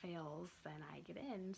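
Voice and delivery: slow guilty whisper. Real, not acted. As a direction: confess it slowly in a whisper. Confiding about someone failing.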